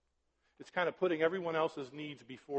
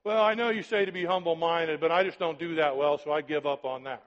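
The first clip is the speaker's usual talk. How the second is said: mock excuse-maker; 2 semitones higher, 8 dB louder than usual. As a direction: ham up the lazy excuse. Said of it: "real live Christians in my life go".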